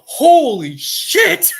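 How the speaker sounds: high, loud, stretched-out laughing "holy shit"; a panicked demon's high, loud cry; panicked demons. Retold or imitated. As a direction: squeal it like a terrified demon.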